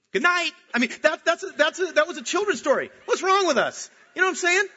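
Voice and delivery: high, loud, incredulous outburst; comic outrage. Real, not acted. Preacher aghast at a children's story, high comic outrage. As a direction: protest loudly, voice jumping way up.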